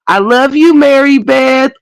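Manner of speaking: high, sugary family goodnight; Waltons family goodnight. Retold or imitated. Mocking The Waltons family with a high sugary goodnight. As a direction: coo it like a sweet TV family. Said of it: "And in that movie".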